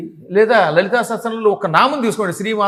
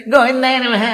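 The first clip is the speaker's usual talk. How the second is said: higher, louder parody of careless chanting; mocking toothy recitation; 6 semitones higher, 5 dB louder than usual. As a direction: push it out through bared teeth. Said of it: "పళ్ళిలా పెట్టుకు చదివితే".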